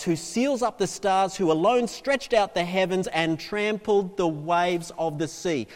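rolling, forceful pulpit reading; declaimed scripture. Acted. Preacher reading Job to the congregation, declaiming. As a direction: build the list like a proclamation.

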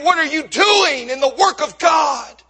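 forceful preaching question, punching DOING; impassioned challenge. Real, not acted. Challenges congregation, then repeats it hushed.

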